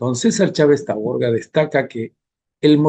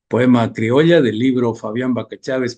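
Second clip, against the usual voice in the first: usual speaking voice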